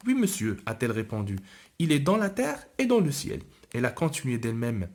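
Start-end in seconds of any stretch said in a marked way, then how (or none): 0.1-0.6 lightly voiced little girl. 1.8-3.4 lightly voiced little girl.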